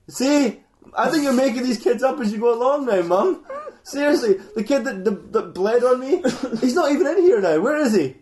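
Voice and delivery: high, loud rant with laughter breaking in; mock exasperated accusation. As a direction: argue with Mum like a fed-up kid. [name] accuses his mum.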